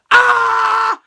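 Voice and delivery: high, loud roar; loud mock battle yell. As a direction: bellow like a Terminator entrance. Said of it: "the motherfucker was like".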